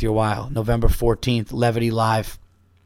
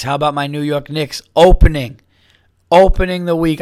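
rising higher and louder with delight; excited; 4 semitones higher, 7 dB louder than usual. Real, not acted. Podcaster bragging to listeners about his Knicks, excited. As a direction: crow about your team's big opening win.

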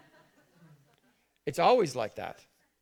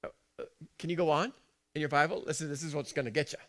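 ordinary talk, nothing marked

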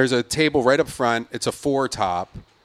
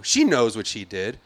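ordinary delivery

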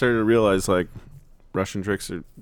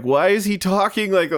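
higher, mock-horrified grandmother; 7 semitones higher than usual. Swiss grandmother appalled at grandchild's accent, mildly horrified. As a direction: imitate a snobbish grandmother taken aback. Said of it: "like, she was horrified".

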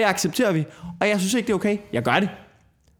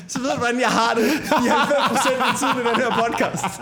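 laughing at your own outburst; 7 semitones higher than usual. Real, not acted. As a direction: talk through laughter after letting off steam. Laughing after the rant.

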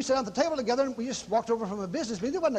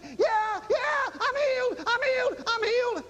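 high, drawn-out joyful cries; re-enacted high-pitched shouts of healing; imitated screaming; 11 semitones higher, slower than usual. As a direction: imitate her crying out in rapture, high-pitched. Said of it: "she's standing there screaming".

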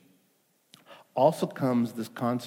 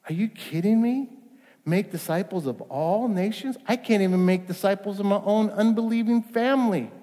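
higher, mock-disbelieving "are you kidding me"; incredulous objection; 7 semitones higher than usual. Pastor voicing the congregation's doubt, mildly incredulous. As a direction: play the doubting listener.

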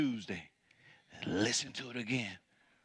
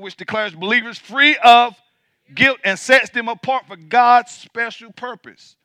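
loud, high, ringing preacher's cry, slowed on key words; full-force preaching; 8 semitones higher, 17 dB louder than usual. Acted. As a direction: preach it out to the whole church, building. Preacher reading scripture at full force.

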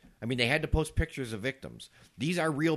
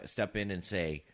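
normal talking voice

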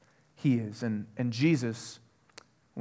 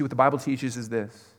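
normal talking voice

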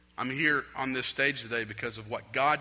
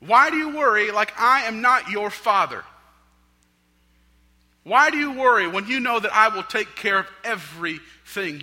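loud, high, preached challenge as Jesus; impassioned pleading rebuke; 10 semitones higher, 13 dB louder than usual. Acted. Jesus challenging his worrying children, impassioned loud rebuke. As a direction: cry it out to the worriers. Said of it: "So what is Jesus saying here?".

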